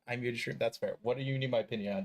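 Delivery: ordinary talk, nothing marked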